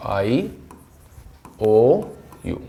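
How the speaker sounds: आईओयू stretched very slowly; drawn-out demo. Shown as a demonstration. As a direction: draw out आईओयू like a teacher. (बहुत धीरे खींचकर)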